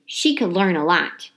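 plain reading voice

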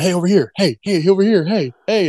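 mock photographers shouting for a look; calling out. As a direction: call out to grab his attention.

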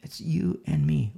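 normal talking voice

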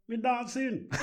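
high-pitched put-on Jamaican accent; fake Jamaican accent. Telling the girl he's dancing, fake Jamaican accent. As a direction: answer in a mock Jamaican accent. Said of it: "in my, um, fake Jamaican accent".

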